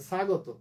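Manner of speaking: স্বাগত as sa-go-to with hissing s; wrong dental s. Shown as a demonstration. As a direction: mispronounce স্বাগত with s instead of sh. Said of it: "দন্ত স দিয়েছিল". (স্বাগত, ভুল দন্ত স দিয়ে)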